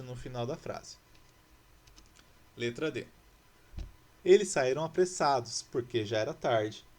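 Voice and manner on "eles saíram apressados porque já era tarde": loud example read. Shown as a demonstration. Reading exercise sentence aloud.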